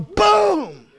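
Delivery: loud, high exploding "boom"; mind-blown boom. Acted. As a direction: burst out like an explosion.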